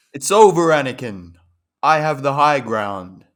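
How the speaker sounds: loud, high dramatic cry, slowing at the end; film-quote voice. Acted. Co-host reading meme award nominations in The Voice, dramatic shout. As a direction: declaim it like a climactic movie duel. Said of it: "Do it in The Voice".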